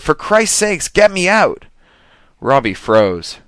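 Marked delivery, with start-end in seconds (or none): 0.0-1.7 high, loud frantic cry; frantic scream to be hauled aboard; panicked plea. A narrator's reading. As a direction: beg for help, terrified. Panicked woman on oar.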